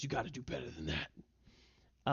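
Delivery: low, sneering taunt said softly; snickering taunt. Acted. Bugbear taunting the hobgoblin, soft sneering taunt. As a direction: needle the enemy with a quiet sneer. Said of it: "Snickers and, uh, says".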